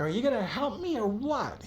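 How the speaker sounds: high, pleading, hurt voice; desperate injured woman. Acted. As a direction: beg for help through the pain. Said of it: "She's like".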